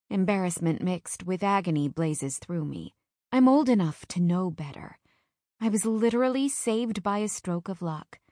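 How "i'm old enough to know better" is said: usual speaking voice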